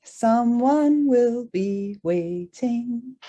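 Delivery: usual speaking voice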